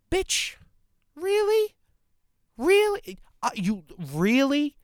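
high, incredulous squeal of disbelief; outraged high-pitched really; indignant disbelief. Retold or imitated. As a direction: shriek it like you can't believe her nerve. Indignant at distrustful customer.

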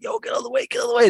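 high shouted warning to move; yelling to clear the way. Retold or imitated. Skater yelling at a man blocking the ledge, high shout. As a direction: call out for him to get out of the way. Shouting at guy in his path.

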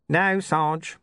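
lightly voiced Nobby giving in; Nobby's reply. Nobby conceding.

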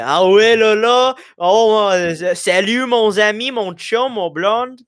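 hammed-up trailer-trash Quebecois accent. Shown as a demonstration. Student showing friends regional French, aggressive Quebecois accent. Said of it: "Quebecois accents are, like, trailer trash".

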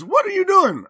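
loud, high-pitched challenge; mom's scolding demand. Retold or imitated. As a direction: imitate a mother catching her kid out. Mom catches child at punch bowl.

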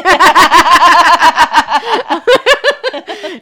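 high, loud burst of laughter; shrieking laugh. Real, not acted. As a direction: let a squealing laugh burst out. Laughing at spider freakout.

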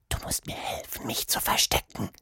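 urgent secret whisper. A narrator's reading. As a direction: whisper for help, afraid of being overheard. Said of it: "legte einen Finger auf ihre Lippen".

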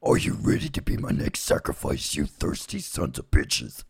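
deep voiceless demon growl. Acted. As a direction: growl it like a satanic priest. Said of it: "you'll know the voice that's coming up next".

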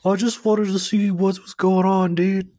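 high, slow, laid-back dude voice; modern dude. Retold or imitated. A time-travelling dude shrugs off the locals, slow and high. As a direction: play a chill guy explaining himself slowly. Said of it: "a modern dude being like".

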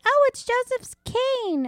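put-on high, slow little-kid voice; imitating her son. Mom voicing her son showing his Play-Doh cane, high childlike. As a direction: play the proud little boy explaining.

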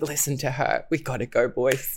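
low, soft, defeated urging; mock sad resignation. Sad guy telling his boys to leave, downcast imitation. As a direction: imitate a dejected man, voice dropped. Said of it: "he's just, like, so sad".

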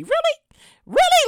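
high, rising disbelief; can't believe what you're seeing; incredulous. Retold or imitated. Rider staring at the maskless lady, incredulous high questions. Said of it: "I'm like".

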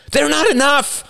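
very high, strained protest; panicked shout. A narrator's reading. Shorter man protesting to the bearded man, high shout. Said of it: "shouted a shorter man".

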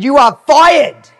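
loud, slow, high-pitched dismissal; indignant firing. Retold or imitated. Retelling herself sacking the accountant, indignant. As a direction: declare it with mock outrage.

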